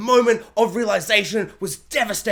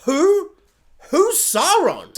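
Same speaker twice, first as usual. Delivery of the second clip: confused hobbit; 8 semitones higher, 4 dB louder, much slower than usual. Retold or imitated. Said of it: "they're gonna be like".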